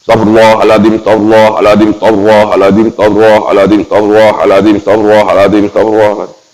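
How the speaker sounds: astagfirullahaladzim rattled off quickly, again and again; quick-fire repeated istighfar; fast recitation. Shown as a demonstration. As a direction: rush through each astagfirullahaladzim in one breath. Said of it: "Baca cepat".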